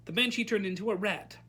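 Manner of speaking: usual speaking voice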